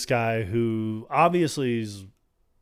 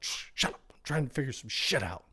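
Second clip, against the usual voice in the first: softer, breathy hush; mock hushed whisper; 7 dB quieter than usual. Connor hushing Murphy in the vent, in a half whisper. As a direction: shush him while you think.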